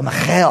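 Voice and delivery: "Michal" with a throaty Hebrew kh; "Michal" in a put-on Hebrew accent. Shown as a demonstration. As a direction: say "Michal" as mi-KHAL, stressed at the end. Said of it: "with a Hebrew accent".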